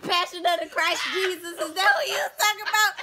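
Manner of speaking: high, loud laughing squeal. Real, not acted. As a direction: crack up while talking. Cracking up at friend's comparison.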